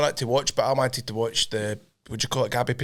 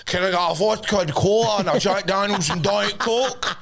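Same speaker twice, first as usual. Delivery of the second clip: sing-song drawn-out order, slower; show-off drinks order, sung out; posh sing-song order; 7 semitones higher than usual. Retold or imitated. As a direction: lilt the drinks order like a toff. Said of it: "I'm like".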